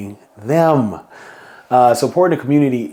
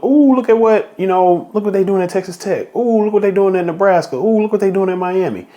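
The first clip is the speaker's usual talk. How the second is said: high, eager voice, much higher, gawking at other teams; imitating excited players; 7 semitones higher than usual. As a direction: play the envious players, wide-eyed and high. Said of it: "They start look".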